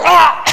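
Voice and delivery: high loud yelping "Ah!"; dog yelp. Retold or imitated. Preacher imitating his dog yelping at the yank, high and loud. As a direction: yelp like a dog choked by the collar. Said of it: "you can hear her go".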